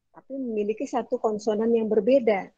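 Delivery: usual speaking voice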